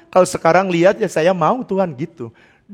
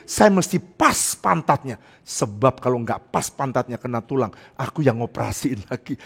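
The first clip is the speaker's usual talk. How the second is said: ordinary talk, nothing marked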